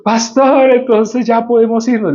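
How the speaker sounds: high, sustained excited plea; hammed-up hopeful appeal in a high voice; eager mimicry. Acts out Jorge eager to leave.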